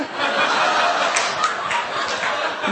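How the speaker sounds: breathless squeaky laugh; high cackling laugh. Real, not acted. Laughing at his own airport story, high wheeze. Laugh burst after the retort.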